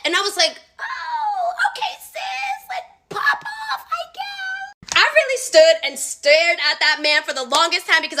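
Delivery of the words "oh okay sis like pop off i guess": very high, breathy fake-nice voice; sugary fake politeness, pitched way up; mock chipper. To the rude customer, a very high fake-sweet voice.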